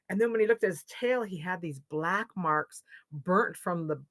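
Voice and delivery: usual speaking voice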